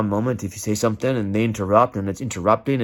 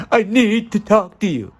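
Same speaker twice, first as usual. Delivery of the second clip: much higher, urgent reading of "I need to talk to you"; 9 semitones higher, 3 dB louder than usual. Shown as a demonstration. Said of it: "how it can change so quickly just in the tone".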